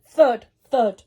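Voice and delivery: usual speaking voice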